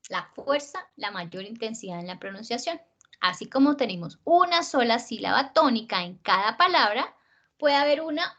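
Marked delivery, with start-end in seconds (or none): 0.1-2.8 "la fuerza, la mayor intensidad" dropping away softly; de-emphasized. Shown as a demonstration. Teacher defining unstressed syllables, soft and unemphatic. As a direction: let "la mayor intensidad en la pronunciación" sink low and soft.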